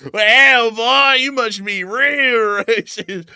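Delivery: loud, high Southern drawl, slowing right down with a laugh; hammed-up Southern good ol' boy voice. Co-host mimicking his younger self to mock racism claims, hammed-up Southern accent. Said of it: "they're talking in that voice".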